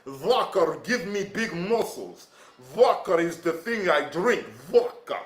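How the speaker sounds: hammed-up Russian accent with broken English; mock Russian accent. Joking to viewers as a Russian vodka drinker, mock Russian accent. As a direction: play a lightly voiced Russian bragging about vodka.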